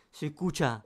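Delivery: ordinary talk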